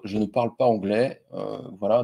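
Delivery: ordinary talk, nothing marked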